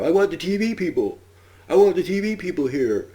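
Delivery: high, loud imitation of a screaming man; mock screaming. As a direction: yell it out of a window, hammed up. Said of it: "this guy's screaming out the window".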